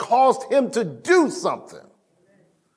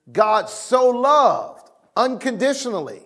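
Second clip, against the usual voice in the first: usual speaking voice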